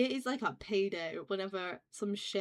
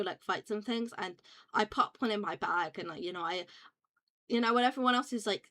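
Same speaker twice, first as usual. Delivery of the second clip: ordinary delivery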